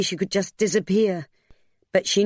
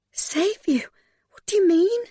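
very high, whispery disbelief; bewildered, breath-filled anxious questions; alarmed bewilderment. A narrator's reading. Frightened, bewildered woman questions him.

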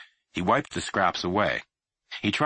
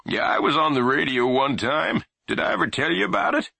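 put-on loud high eager old man; eager grandpa; 9 semitones higher, 4 dB louder than usual. A narrator's reading. Grandfather eager to tell his radio story, loud. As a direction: grandfather launching a tale.

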